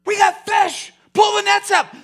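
high, loud thrilled call to the crew; excited shout. Acted. Peter calling to his crew over full nets, excited shout. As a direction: shout it out, overjoyed at the catch. Peter calls out catching fish.

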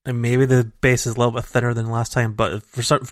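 usual speaking voice

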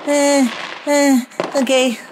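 high, halting panicked stammer; shaky panic. Acted. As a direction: catch your breath between each word. Panicking dog trying to calm.

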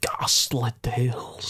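slow mock-spooky title reading; drawn-out Scottish horror announcer; Scottish spooky narrator. Echoing co-host's horror channel name, slow spooky Scottish.

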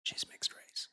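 mock-secretive whisper. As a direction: lean in and whisper it like a scandal. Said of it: "You don't have to whisper that".

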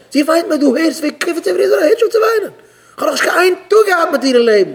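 loud, very high-pitched mock outburst; worked-up complaining. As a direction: complain at the top of your register. Acted complaint about wife's crying. (אויפגערעגטע הויכע טענות)

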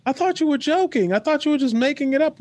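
high, whiny excuse; high whiny teen. Retold or imitated. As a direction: whine out the excuse. Daughter's excuse quoted.

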